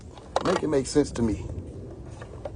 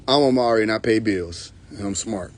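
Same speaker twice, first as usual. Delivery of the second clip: normal talking voice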